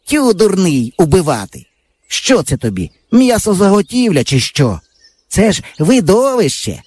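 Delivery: put-on scoffing boy. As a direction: wave off your friend's worry. Yava scoffs at friend. (хлопчина зневажливо відмахується)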